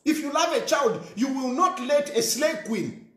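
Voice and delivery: ordinary delivery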